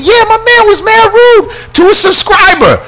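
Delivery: high indignant complaint. Retold or imitated. Host complaining about security, high and indignant. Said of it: "I said".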